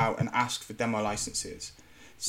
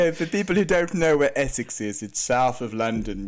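Essex accent; 5 dB louder than usual. Shown as a demonstration. Explaining Essex to colleagues in a hammed-up Essex accent. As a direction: lay on the Essex accent for a laugh. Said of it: "That's Essex".

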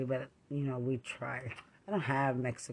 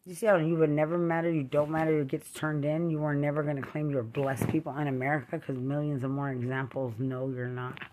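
ordinary talk

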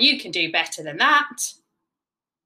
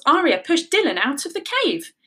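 usual speaking voice

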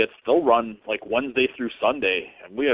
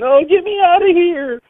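mock girlish screaming; 10 dB louder than usual. Host imitating tween girls screaming in a haunted house, shrieking. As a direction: squeal like panicking tween girls. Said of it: "they're just all screaming".

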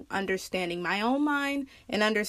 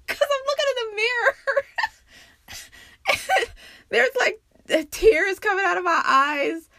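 laughing through tears; 12 semitones higher, 5 dB louder than usual. Real, not acted.

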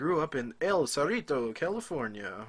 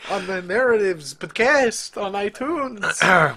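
announcer voice; 5 semitones higher, 10 dB louder, slower than usual. Pretend. Mock announcer read.

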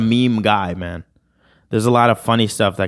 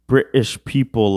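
ordinary talk

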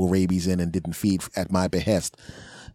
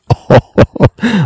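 loud, high burst of laughter; mock gloating laugh; 8 semitones higher, 13 dB louder than usual. Plotting a funny prank with the dog man, loud gloating laugh. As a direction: cackle loudly over your scheme.

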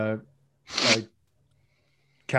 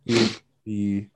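normal talking voice

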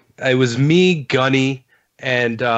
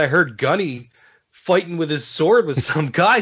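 ordinary talk

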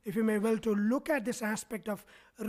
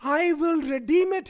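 higher, louder, emphatic quote; emphatic declaration; 4 semitones higher, 6 dB louder than usual. Retold or imitated. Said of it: "he emphatically says".

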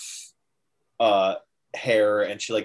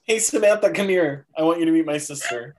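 mock Arthur introducing his bride; 6 semitones higher than usual. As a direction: play the oblivious brother showing off his bride. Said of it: "He goes".

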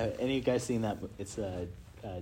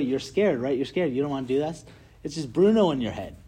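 ordinary talk

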